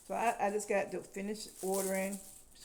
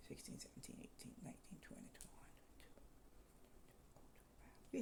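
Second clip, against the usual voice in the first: counting years under the breath, fading to a whisper; 7 semitones lower, 20 dB quieter, faster than usual. Real, not acted. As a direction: count quietly to yourself, almost silent. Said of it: "counting under breath".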